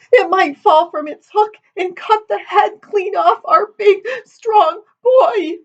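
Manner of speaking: very high wail building to sobs; weeping. A narrator's reading. Daughter dreads the axe falling on her son, wailing. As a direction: break down as the imagined disaster nears. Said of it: "Amity sat weeping".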